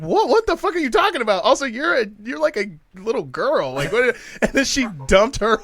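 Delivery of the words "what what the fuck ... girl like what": incredulous. Retold or imitated. Baffled retort to accusing girl.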